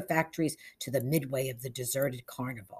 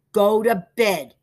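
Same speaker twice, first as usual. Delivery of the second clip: loud, slow, raised-pitch command; stern order; 6 semitones higher, 11 dB louder, slower than usual. A narrator's reading. Father ordering his son to bed, stern. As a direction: order him off, each word weighty.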